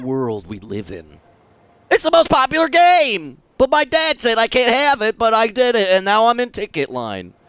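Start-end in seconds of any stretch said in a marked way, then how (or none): 1.9-7.3 put-on much higher and louder, eager child; excited kid voice. Kid in the game line answering him, excited child voice. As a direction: bubble over like a kid bragging.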